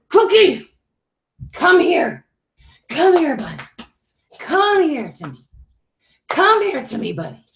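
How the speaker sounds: high coaxing calls to a pet; calling the dog. Real, not acted. Calling the dog away from the window.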